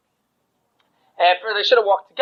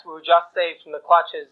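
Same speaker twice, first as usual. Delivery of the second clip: ordinary delivery, nothing marked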